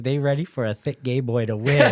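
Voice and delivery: loud cocky brag. Retold or imitated. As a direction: crow it like you've already won. Bragging he will win.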